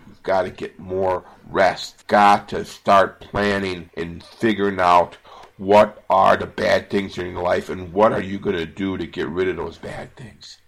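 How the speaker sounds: usual speaking voice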